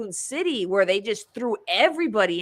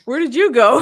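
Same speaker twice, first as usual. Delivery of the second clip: much louder and higher, demanding; mock startled yell; 5 semitones higher, 10 dB louder than usual. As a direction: call out to the friend coming back. Imagined shout at friend.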